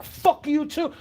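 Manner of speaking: high, loud mocking snap-back; retorting fuck you. Ranting vlogger retorts to judgmental Christians, high and loud. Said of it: "and I'm like".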